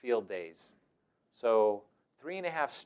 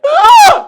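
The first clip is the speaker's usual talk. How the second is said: very high, very loud mock yelp; hammed-up startled scream; startled kid yelp; 24 dB louder than usual.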